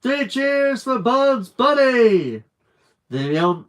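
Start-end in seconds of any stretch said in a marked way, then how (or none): 0.0-2.4 slow high loud cheer; cheering shout. Acted. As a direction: cheer for the hero. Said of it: "everyone shouted".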